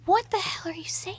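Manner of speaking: exasperated. Retold or imitated. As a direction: act out baffled exasperation without raising volume. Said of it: "seeing me get exasperated".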